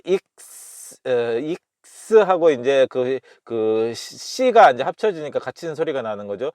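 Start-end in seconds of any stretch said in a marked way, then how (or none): 0.1-1.0 익스 drawn out into a held s. Shown as a demonstration. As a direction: sound out 익스 slowly as k plus hissing s. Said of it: "X는 이제 크스 발음이니까".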